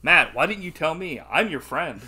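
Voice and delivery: loud mock appeal to a friend; mock weird line. Host parodying the line as a friend, mock appeal. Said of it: "I wouldn't be like".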